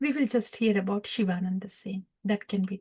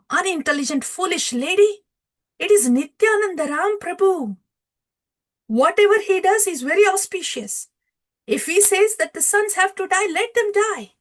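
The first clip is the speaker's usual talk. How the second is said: high, raised-voice acted rebuke; husband's rebuke; 9 semitones higher, 5 dB louder than usual. Shivananda Sen corrects his crying wife, emphatic rebuke. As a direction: insist emphatically, pitched up and louder. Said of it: "Shivananda Sen says".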